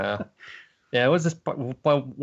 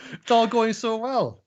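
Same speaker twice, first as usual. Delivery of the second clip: much higher, slow ironic sigh of doom; sarcastic tragic whine; mock lament; 12 semitones higher, 4 dB louder, slower than usual. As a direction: overplay the sorrow. Ironic lament over the plot.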